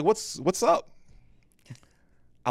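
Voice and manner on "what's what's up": high, loud bewildered question; startled out of sleep, high and loud; a hint of the groggy wife; confused surprise.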